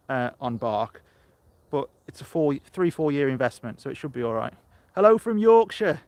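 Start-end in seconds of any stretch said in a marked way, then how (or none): 4.9-6.0 a loud, high-pitched attempt at a Yorkshire accent. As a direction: greet viewers in a hammed-up Yorkshire accent. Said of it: "I used to be able to do a Yorkshire accent".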